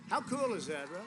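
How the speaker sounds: ordinary talk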